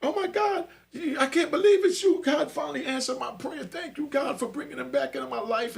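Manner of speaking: put-on girl on the phone overjoyed to hear from him; overjoyed girlfriend. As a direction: thank God breathlessly, high and giddy. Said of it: "She was like".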